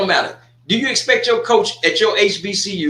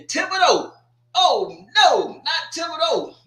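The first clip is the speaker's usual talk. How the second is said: mock dismay; 10 semitones higher than usual. Real, not acted. Dismay at game location.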